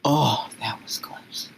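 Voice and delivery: slow breathy whisper of relief; relieved whisper. Acted. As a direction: whisper it as the danger passes. Relieved once guards leave.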